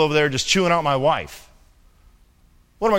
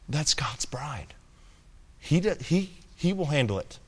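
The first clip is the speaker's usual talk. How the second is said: whisper; 8 dB quieter than usual. Real, not acted. Preacher whispering a rebuke to the congregation, hushed. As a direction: drop to a hush to make it land.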